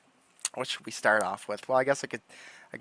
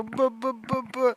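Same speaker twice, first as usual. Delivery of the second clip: higher playful boos; mock booing; 11 semitones higher, slower than usual. Booing the troll in the retelling, playful mock boos. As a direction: keep taunting with high boos. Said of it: "I just started doing that".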